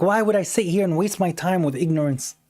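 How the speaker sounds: ordinary talk, nothing marked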